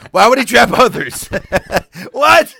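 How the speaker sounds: loud, high-pitched incredulous outburst; laughing disbelief. Real, not acted. Reader baffled by what he just read, loud laughing disbelief. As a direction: cry out baffled through laughter. Incredulous at his own misreading.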